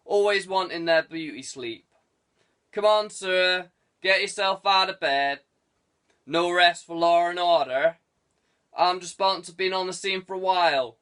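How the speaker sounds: put-on chuckling old copper on the phone; teasing old desk sergeant. A narrator's reading. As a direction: rib the lazy young inspector.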